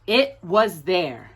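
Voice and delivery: usual speaking voice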